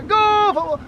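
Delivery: shouted high cheer, stretched slow; booming stadium cheer. Acted. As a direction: chant it like a fan in the stands. Repeated cheer per territory.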